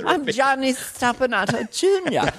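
dainty British echo of dad; British-accented child. Acted. Son repeating his name for dad, prim British accent. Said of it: "developing a British accent".

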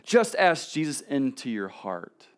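"Just ask Jesus into your heart" drawn out slowly, each word weighed; questioning the phrase at a slow, deliberate pace; slow, pointed quote. Shown as a demonstration. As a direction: lay it out slowly as if doubting it. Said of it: "I'd never seen where it says".

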